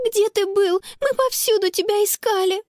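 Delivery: high-pitched, agitated questioning; worried relief. A narrator's reading. Sister to found brother after searching everywhere, agitated. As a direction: pour it out in a high voice.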